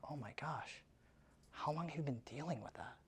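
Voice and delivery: quiet, sympathetic question; soft sympathy. Acted. Coach voicing the agent gently to a grieving seller. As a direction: hush down and show you care.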